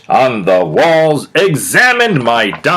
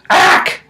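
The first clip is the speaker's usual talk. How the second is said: voiceless disgusted ack from the cat; kitty's ack. Acted. Kitty recoiling from vegetables, unvoiced ack. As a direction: spit out a breathy ack. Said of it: "says the kitty".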